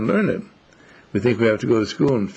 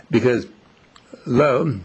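ordinary talk

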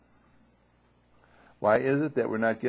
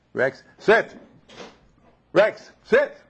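much higher, louder command; shouting at the dog; 12 semitones higher, 9 dB louder, slower than usual. Retold or imitated. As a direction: bark the order at the dog. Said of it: "Whereas the shouting".

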